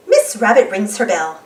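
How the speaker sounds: plain reading voice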